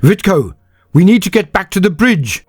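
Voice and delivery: high, loud, urgent yell; urgent shout. A narrator's reading. Senyavina urges Vitko back to the bridge, shouting. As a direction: yell over the din of battle. Calling over the battle.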